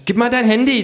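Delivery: high kangaroo voice, explaining; lecturing kangaroo. Acted. Kangaroo explaining capitalism to narrator, high lecturing.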